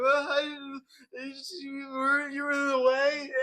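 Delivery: high, near-crying whine; whiny protest. Retold or imitated. Student telling the angry man he was in the way, high teary whine. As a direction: whimper it out, flustered and close to tears. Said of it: "I'm like".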